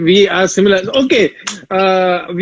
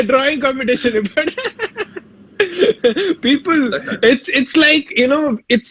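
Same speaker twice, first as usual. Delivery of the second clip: laughing; 7 semitones higher, 3 dB quieter than usual. Real, not acted. Laughing at rivalry question.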